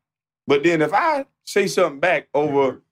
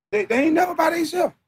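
exasperated shout; 13 semitones higher than usual. Real, not acted. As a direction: yell it out in disbelief. Ranting about friends never alone.

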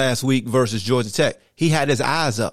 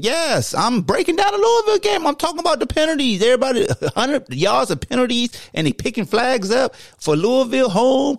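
much higher, cocky, sing-song alter ego voice; Petty Hawk persona; 13 semitones higher than usual. Acted. Host's Petty Hawk persona gloating over penalties, high and cocky. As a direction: strut it like a show-off bird.

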